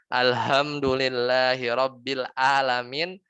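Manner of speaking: slow "Alhamdulillahi Rabbil Alamin" with a heavy ha and a hamzah "Alamin"; double mistake. Shown as a demonstration. Ustaz demonstrating two errors at once, slow chant. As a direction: recite slowly with heavy "h" in "Alhamdulillahi" and glottal "Alamin". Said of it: "Itu double tuh salahnya".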